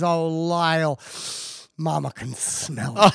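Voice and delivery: slow, knowing mama voice; drawn-out mock-motherly reassurance; imitated mother. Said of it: "And she goes".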